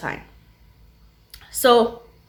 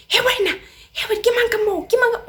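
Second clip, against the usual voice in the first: mock furious yelling; 14 semitones higher, faster than usual. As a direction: yell like a woman gone wild. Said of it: "she's going batshit crazy".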